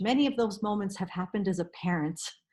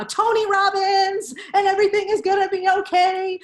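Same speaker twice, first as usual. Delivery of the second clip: mock positivity; 8 semitones higher, 7 dB louder than usual.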